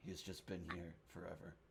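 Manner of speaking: ordinary talk, nothing marked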